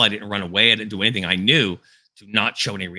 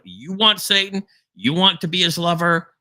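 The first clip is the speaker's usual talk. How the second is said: higher, pointed rhetorical challenge; challenging preaching; 8 semitones higher than usual. Acted. Preacher daring listeners who choose Satan, challenging preaching. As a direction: throw the question at the congregation.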